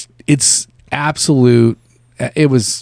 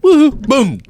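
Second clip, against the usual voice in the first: high, loud taunting woohoo boom; hammed-up gloating chant; mock gloating woohoo; 12 semitones higher, 3 dB louder than usual. Imitating Eric taunting roommates after every melee kill. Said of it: "he'd be like".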